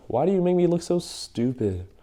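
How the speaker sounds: high-pitched tearful whine, hammed up; mock crying. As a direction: imitate a wronged wife wailing her complaint. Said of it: "she comes up and she's crying".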